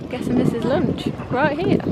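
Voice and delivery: usual speaking voice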